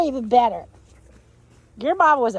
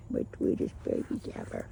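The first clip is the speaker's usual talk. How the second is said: much lower, hushed tender coo; soft whisper; 10 dB quieter than usual. Real, not acted. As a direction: murmur to the babies. Tender words to puppies.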